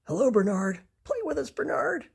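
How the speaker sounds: new friends calling him over; friendly animals. Acted. Animals greeting Bernard.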